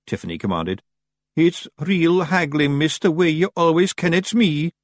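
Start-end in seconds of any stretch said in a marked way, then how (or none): 1.4-4.7 higher, slow little Scots Feegle; sheepish tiny Scots pixie. A narrator's reading. Daft Woolly Feegle speaking.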